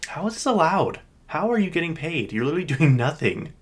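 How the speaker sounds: high-pitched, incredulous complaint; retold outrage. Retold outrage at idle clerk.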